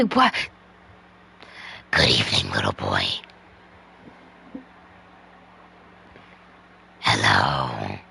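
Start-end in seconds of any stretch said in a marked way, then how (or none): 1.9-8.0 demon voice. Pretend. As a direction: greet the little boy like a demon. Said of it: "my demon voice".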